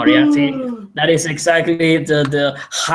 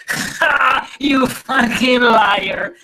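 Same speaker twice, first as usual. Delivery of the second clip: mock laughing jeer; 9 semitones higher, 3 dB louder, much slower than usual. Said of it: "and you go".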